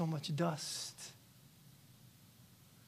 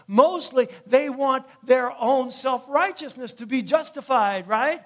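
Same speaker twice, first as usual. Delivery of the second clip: preaching that climbs to loud and higher; rising pulpit fervor, getting louder; building preaching; 5 semitones higher, 11 dB louder than usual. Acted. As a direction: drive the story harder with every line. Preaching builds over the Pharisees story.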